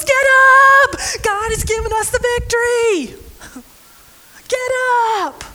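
rally them, half laughing; excited shout. Retold or imitated. Gideon calling his camp to rise, excited cry. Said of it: "and shouted".

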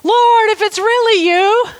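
mock shout of an excited Peter. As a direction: call out to him, half-hoping. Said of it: "Peter shouted".

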